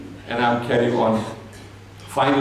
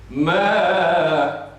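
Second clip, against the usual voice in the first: slow melodic Quranic chant; Quran recitation; 3 semitones higher, slower than usual. Acted. Woman answering the traveller with a chanted verse. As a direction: intone the verse like tajweed recitation.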